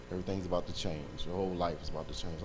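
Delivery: low, soft inner voice; voice in the mind. Retold or imitated. Man retelling a message heard in his mind at night, low and hushed. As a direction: quietly voice a message heard inwardly. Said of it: "I would hear this in my mind".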